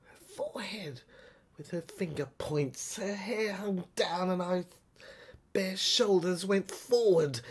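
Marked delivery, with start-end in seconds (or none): none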